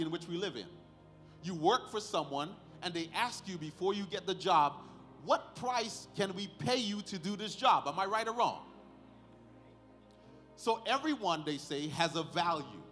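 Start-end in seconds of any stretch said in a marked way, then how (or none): none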